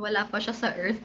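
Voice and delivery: normal talking voice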